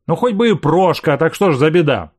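snappy, spiteful retort; angry. A narrator's reading. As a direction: throw it back with spite. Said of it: "со злостью заметила она".

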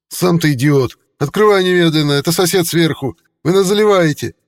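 loud, high angry yell; shouting. Acted. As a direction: bellow through the door. Yelling through the door.

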